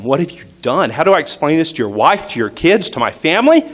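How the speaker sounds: high, loud desperate yelling at a friend; retold yelling. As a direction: shout it out in panic and disbelief. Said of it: "I start yelling at him".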